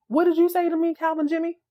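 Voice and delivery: high-pitched indignant question, rising at the end; disbelief. Retold or imitated. Woman confronting boyfriend about canceled campaign, disbelief. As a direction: imitate her challenging him, voice way up. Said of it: "She drops the giddy".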